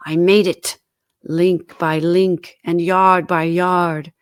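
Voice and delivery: loud, slow heavy declaration; grim ghost. A narrator's reading. Ghost tells Scrooge of its chain, slow and heavy. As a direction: weigh every link of it.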